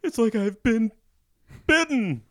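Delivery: high, loud fake terror; mock panic. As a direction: cry out as if dying. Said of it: "he's like".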